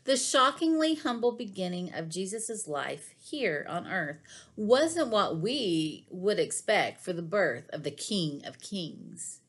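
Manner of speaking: ordinary talk, nothing marked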